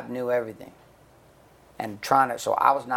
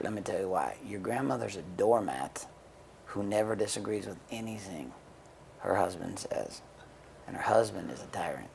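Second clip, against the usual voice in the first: soft, lowered voice; confiding explanation; 3 semitones lower, 8 dB quieter than usual. Retold or imitated. Telling a kid the truth about grandparents, softly confiding. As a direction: quietly let them in on the truth.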